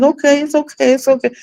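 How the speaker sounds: high, mock soothing okay; coworker soothing. Coworker calms her in pain, mock soothing and high. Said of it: "She's like".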